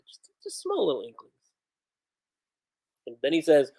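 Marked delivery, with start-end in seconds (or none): 0.1-1.3 "small little inklings" said much softer as an aside; soft repeat. Real, not acted. Repeating the sweat detail to viewers, much softer. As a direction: let "small" fall away quietly, under the breath.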